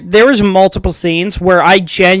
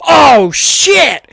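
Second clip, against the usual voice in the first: retold panic shout; mock panicked yell; 5 semitones higher than usual. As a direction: yelp it like you're caught in the open. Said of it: "he's just like".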